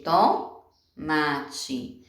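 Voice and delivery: TO... MATE, very slow, leaning on "to"; teacher sounding out "tomate" with the "to" set apart; first syllable separated. Shown as a demonstration. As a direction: stretch "tomate" to show its opening "to". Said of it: "palavra que começa com to".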